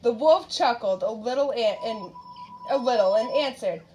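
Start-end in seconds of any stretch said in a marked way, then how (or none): none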